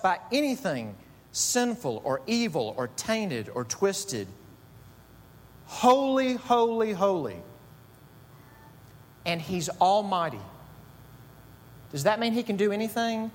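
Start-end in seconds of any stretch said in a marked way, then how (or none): 5.7-7.4 rising proclamation. Real, not acted. Preacher repeats the threefold holy.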